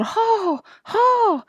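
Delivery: very high, stretched cries; frantic driver's holler; panicked yell. A narrator's reading. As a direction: yell the command desperately. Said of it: "Almanzo yelled".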